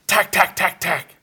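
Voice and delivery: clicky imitated tack tack of a wrench; mock wrench tapping. Mechanic under the car, imitated wrench taps. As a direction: tap out the wrench sounds with your voice. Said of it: "And I hear".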